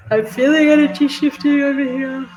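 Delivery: real yawn overtaking the speech; talking through a yawn. Real, not acted. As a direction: yawn right through the words. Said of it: "Yawning is, um, a clear indication".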